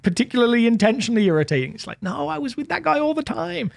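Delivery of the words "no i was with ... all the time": higher-pitched sibling griping; put-upon brother's complaint; mock exasperation. Brother complains about always living with that guy, mock exasperated.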